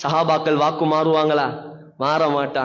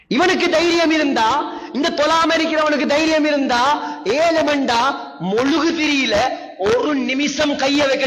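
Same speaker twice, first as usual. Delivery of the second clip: higher, raised taunting challenge; preaching at full force; 6 semitones higher than usual. Acted. Preacher daring the men who skip prayer, fiery. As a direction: dare the listener, fired up.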